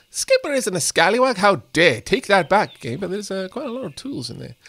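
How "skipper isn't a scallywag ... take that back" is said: hammed-up offended protest, a bit louder; mock indignation. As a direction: pretend to be outraged on Skipper's behalf. Mock outrage defending a character.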